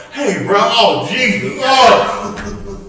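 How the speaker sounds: high, slowed, fading moan through laughter; mock swooning victim. Preacher acting faint from a friend's bad breath, hammed-up swoon. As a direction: ham up being overcome by the smell.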